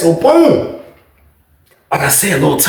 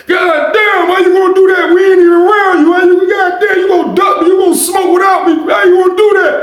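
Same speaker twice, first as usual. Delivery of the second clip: put-on yelling old uncle; 13 semitones higher than usual. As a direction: play an offended uncle hollering through the door.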